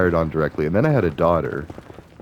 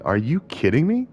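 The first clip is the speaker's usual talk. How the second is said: incredulous; 8 semitones higher than usual. Real, not acted. Outrage at the layoff pay.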